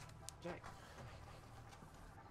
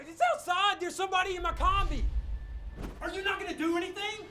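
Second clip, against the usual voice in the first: loud, high, panicked calling out; alarmed shout; 9 semitones higher, 5 dB louder than usual. Acted. Owner yelling to police that someone's in his van, loud and high. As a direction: yell it in alarm to the cops.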